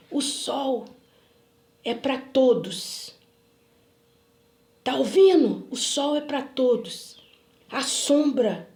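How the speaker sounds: slow, emphatic imitated pronouncement; acted weighty declaration with long pauses; solemn proclamation. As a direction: proclaim like a prophet, word by word. Retold man's solemn pronouncement.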